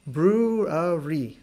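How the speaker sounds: very slow brewery with a soft R; slow model word. Shown as a demonstration. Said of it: "This is a very soft R in English".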